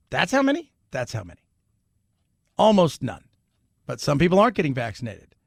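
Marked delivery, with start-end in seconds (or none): 0.1-0.7 mock surprise. 0.9-1.4 flat quiet answer. Retold or imitated.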